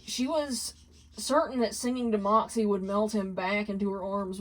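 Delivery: usual speaking voice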